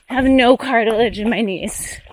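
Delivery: ordinary delivery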